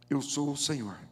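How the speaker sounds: ordinary delivery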